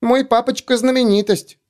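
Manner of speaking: put-on high squeaky four-year-old girl; squeaky little girl voice. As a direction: pipe it up like a tiny girl. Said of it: "писклявым голоском четырёхлетней девочки".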